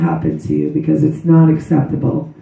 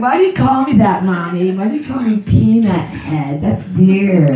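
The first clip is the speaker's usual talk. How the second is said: put-on little girl voice; 3 semitones higher than usual. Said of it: "She's like".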